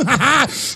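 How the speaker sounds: gleeful laugh. Real, not acted. Gloating, joke is on them.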